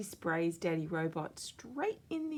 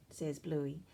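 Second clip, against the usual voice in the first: ordinary delivery, nothing marked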